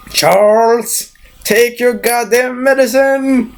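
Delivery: high, slow, drawn-out mock yell; hammed-up scolding, pitched well up; exasperated order. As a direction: stretch each word in acted exasperation. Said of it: "That's my reaction if it would be that".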